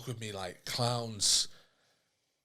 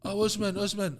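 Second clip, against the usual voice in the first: calling out; 11 semitones higher than usual. Retold or imitated. Cleaner calling through door.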